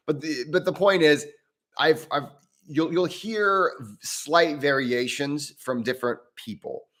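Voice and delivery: ordinary talk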